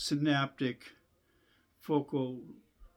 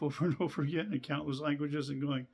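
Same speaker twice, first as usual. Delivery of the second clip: ordinary talk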